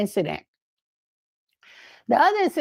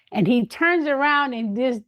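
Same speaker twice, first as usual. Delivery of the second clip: ordinary talk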